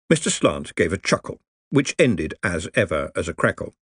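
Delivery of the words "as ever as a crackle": ordinary delivery, nothing marked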